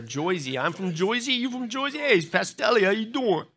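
playful, hammed-up New Jersey accent, pitched high. Preacher clowning for his congregation after the shout-out, mock New Jersey accent. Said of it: "Should have done our New Jersey accent there".

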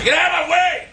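high-pitched, impatient yell; angry shout. Real, not acted. Gamer blocked by another player, impatient shout. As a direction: order them aside at full voice.

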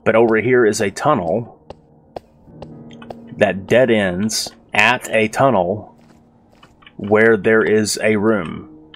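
slow, deliberate, spelling it out; exasperated explanation. Real, not acted. Gamer spelling out the door and tunnel, exasperated. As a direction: lay it out word by word, slowly.

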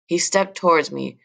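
plain reading voice

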